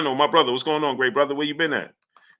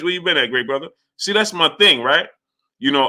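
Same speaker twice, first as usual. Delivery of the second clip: usual speaking voice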